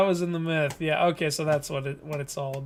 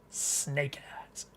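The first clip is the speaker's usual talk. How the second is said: whisper; 4 semitones lower, 14 dB quieter than usual. Pretend. Aside during dramatic scene.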